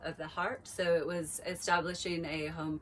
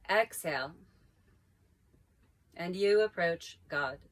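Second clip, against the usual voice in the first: reciting a mantra; 2 semitones higher, 3 dB louder than usual. Acted. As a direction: let each phrase land, then wait. Recites mantra with long pauses.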